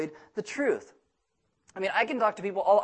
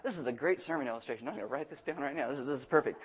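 quiet aside to self; 6 semitones lower, 10 dB quieter, faster than usual. Retold or imitated. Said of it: "I'm like".

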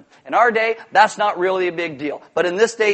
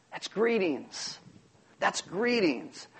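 understated; 8 dB quieter, much slower than usual. Shown as a demonstration. Preacher explaining Jesus' greeting, quiet understatement. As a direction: let it drop quietly, drawn out.

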